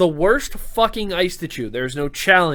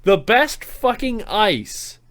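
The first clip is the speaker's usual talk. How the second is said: slow dramatic build; slower than usual. Real, not acted.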